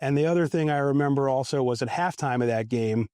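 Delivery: normal talking voice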